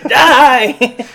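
high, loud comic yell; mock shriek. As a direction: shriek it, laughing. Troll Two quote, laughing.

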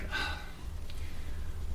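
long breathy exhale; heavy, resigned sigh; weary sigh. Acted. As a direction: let the air out slowly. Blind sighs before giving up.

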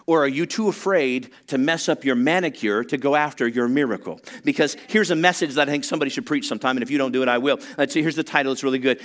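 normal talking voice

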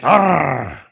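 a long rolled "Arr". Retold or imitated. Rolled "Arr" mocking the grandfather's R's. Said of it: "kept rolling his R's".

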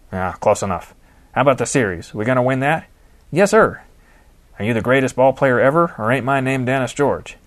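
hearty, lightly voiced gruff manager; an old ballclub boss grumbling fondly; gruff old baseball manager. D G quizzing Jason.

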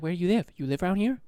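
put-on light Chinese-accented English; imitated parlor worker's accent. Parlor worker questioning a customer, imitated Chinese accent. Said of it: "That was just her accent".

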